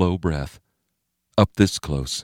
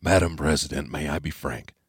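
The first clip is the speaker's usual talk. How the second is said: much softer, confiding; leaning in quietly; 2 semitones lower, 6 dB quieter than usual. A narrator's reading. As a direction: lean close so only she hears. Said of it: "so only she could hear him".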